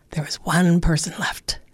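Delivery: soft conspiratorial whisper. A narrator's reading. Narrator to children, whispered. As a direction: hush them for the hero. Storyteller builds suspense.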